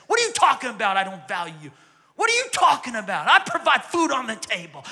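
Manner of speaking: loud indignant outburst; upset husband. Acted. Husband protests loudly to his wife in the counselor's office. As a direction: bark back at her, offended. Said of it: "The husband gets upset".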